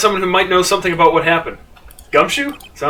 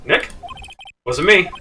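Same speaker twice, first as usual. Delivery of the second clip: normal talking voice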